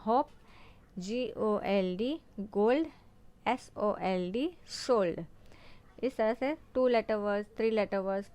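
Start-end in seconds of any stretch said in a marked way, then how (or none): 0.1-5.2 slow spelling, then word. Shown as a demonstration. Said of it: "four letter words भी बनाए हैं".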